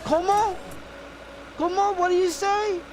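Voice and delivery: high, loud puzzled mimicry; high-pitched mock bafflement; imitated baffled call-taker. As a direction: squeal her confusion back, mockingly. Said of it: "And she goes".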